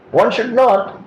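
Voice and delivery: ordinary talk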